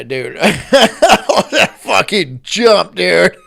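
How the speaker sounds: slow, laughing through it; real laughter, drawn out. Real, not acted. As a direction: crack up mid-line. Laughing at own joke.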